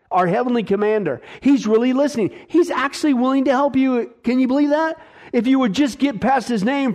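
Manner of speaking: usual speaking voice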